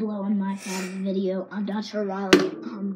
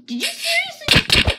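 incredulous; slower than usual. Real, not acted. Disbelief at opponent in game.

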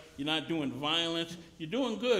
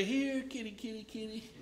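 lightly sung higher cat call, fading soft; 4 semitones higher, 4 dB quieter than usual. Pretend. Preacher mocking calling a growling lion like a house cat, light cat call. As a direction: coax a kitty, trailing off. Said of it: "we wouldn't say".

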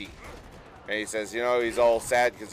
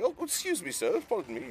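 mock British lady; 10 semitones higher, 5 dB quieter than usual. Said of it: "British lady".

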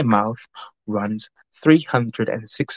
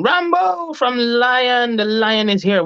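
drawn-out welcome; 11 semitones higher, slower than usual. Real, not acted. Welcoming a viewer, calling out.

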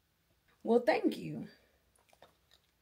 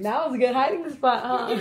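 normal talking voice

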